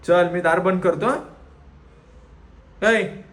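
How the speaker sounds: loud warning call; calling the dog. Real, not acted. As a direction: threaten to shut the door on him. Threatening dog to come. (दार बंद करण्याची धमकी देत हाक)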